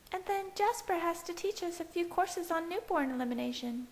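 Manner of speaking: breezy and eased; Alice's voice. A narrator's reading. Alice continues, relaxed.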